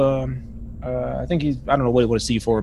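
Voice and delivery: ordinary delivery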